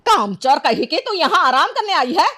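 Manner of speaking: high-pitched, indignant outburst; angry scolding. Acted. Mistress catching maid resting, angry scolding. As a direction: berate the lazy servant.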